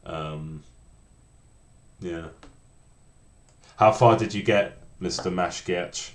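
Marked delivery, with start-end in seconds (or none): none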